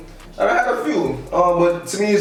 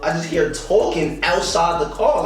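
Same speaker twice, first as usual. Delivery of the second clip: ordinary talk, nothing marked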